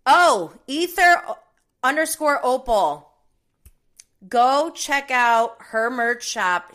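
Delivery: big excited plug; excited shoutout. Real, not acted.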